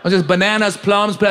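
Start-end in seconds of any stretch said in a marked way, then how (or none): none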